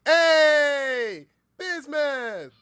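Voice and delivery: loud, high, joyful hail across the room; excited yell of a name; calling out. Real, not acted. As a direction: shout a greeting to a friend. Calling out to a fan.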